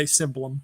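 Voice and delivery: "cymbalum" opening like "sim"; SIM-ba-lum for "cymbalum", as in English; English pronunciation. Shown as a demonstration. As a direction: say "cymbalum" with an s at the start. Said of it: "in English we say".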